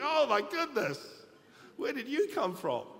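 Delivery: acted astonishment at an angel voice; amazed. Preacher acts a viewer stunned by a shy singer, amazed.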